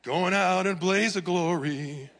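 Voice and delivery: hammed-up blaze of glory; mock grand exit. Pastor jokes to congregation, slow loud mock proclamation. Joking about emptying the church.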